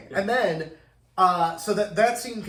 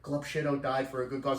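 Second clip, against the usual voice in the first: mock calm heroine; 4 semitones lower, 6 dB quieter than usual. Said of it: "they go on like that".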